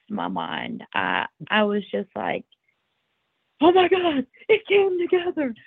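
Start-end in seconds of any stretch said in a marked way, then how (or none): 3.6-5.5 high, excited gasp of joy; freaking out. Retold or imitated. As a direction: burst out in disbelieving joy. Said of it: "And I was freaking out".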